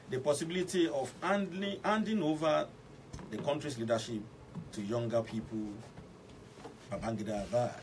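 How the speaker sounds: formal radio news delivery in English; news read. Acted. Radio host reading the morning news analysis to listeners, formal news read. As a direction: read the bulletin crisply to listeners.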